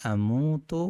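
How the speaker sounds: আমুতু with the first vowel drawn out; long vowel. Shown as a demonstration. Teacher shows the long vowel in আমুতু to students. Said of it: "একালি টান".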